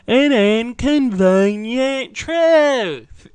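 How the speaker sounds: hammed-up ponderous Al Gore; Al Gore impression. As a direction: drag it out like a solemn lecture, way up and loud. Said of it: "my Al Gore impression".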